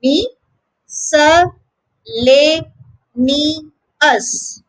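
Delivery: five slow syllables, clear gaps between each. Shown as a demonstration. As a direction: break Me-sa-le-ni-us into separate, drawn-out syllables. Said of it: "saying a big word like this correctly".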